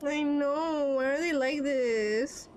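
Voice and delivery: ordinary talk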